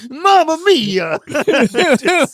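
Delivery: slow, high faux Italian New York accent. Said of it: "weird faux Italian New York accent".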